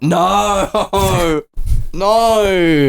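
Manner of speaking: high, laughing no, no; playful horror that breaks into laughter; mock dismay. A Sheffield hater laughing in mock dismay as the city is hit. Reacting to Sheffield being bombed.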